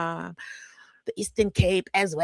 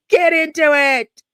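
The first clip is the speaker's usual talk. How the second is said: louder, slower mock demand; impatient viewer; 8 semitones higher, 10 dB louder, slower than usual. Mocking a viewer telling her to get to the point, loud and slow. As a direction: bark it like an impatient commenter. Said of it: "someone is ... about to type".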